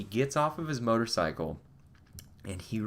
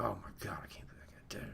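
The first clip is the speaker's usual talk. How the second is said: whispered, much softer, scandalised; gossiping whisper; 13 dB quieter, faster than usual. Retold or imitated. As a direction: whisper it to a friend in disbelief. Gym guy whispering to friend.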